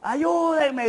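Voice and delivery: high-pitched mock scream of "¡Ayúdenme!"; retold cry for help. Pastor retelling his hospital pain, high mock scream.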